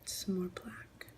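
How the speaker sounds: slow, soft whisper to herself; whispered aside. Real, not acted. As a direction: murmur it slowly under your breath. Whispering while painting nails.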